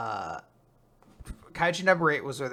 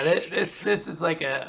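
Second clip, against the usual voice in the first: ordinary delivery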